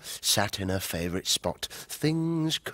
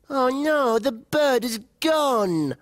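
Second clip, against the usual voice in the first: higher, louder dismayed wail; dismayed cry; 13 semitones higher, 4 dB louder, slower than usual. Acted. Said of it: "cried Flo".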